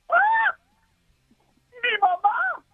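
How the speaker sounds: high, loud shriek; shrieking wife. Acted. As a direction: scream it high like the horrified wife. Wife screams discovering mistake.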